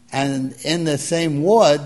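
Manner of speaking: ordinary delivery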